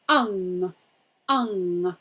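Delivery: "Ung" hummed low through the nose, tongue behind teeth; low nasal "ung"; ung sound. Shown as a demonstration. Teacher shows the ung with tongue behind bottom teeth. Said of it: "So we're saying ung".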